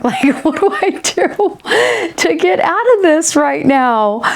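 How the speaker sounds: laughing panic. Retold or imitated. Stuck on a bad date, laughing while panicking. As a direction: giggle out a desperate escape plan. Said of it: "And I'm just going".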